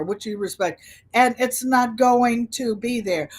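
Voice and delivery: ordinary delivery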